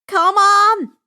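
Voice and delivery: loud, high urgent shout. Acted. Grover holding the net open for friends, shouting. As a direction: yell to hurry them along. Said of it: "Grover shouted".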